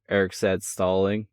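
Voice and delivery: plain reading voice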